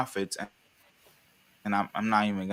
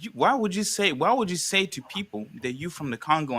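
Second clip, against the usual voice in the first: higher-pitched retold exasperation; baffled inner protest; incredulous challenge; 7 semitones higher than usual. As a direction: replay your disbelief at being lied to. Retold inner outburst at a lie.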